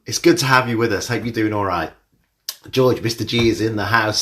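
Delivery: ordinary talk, nothing marked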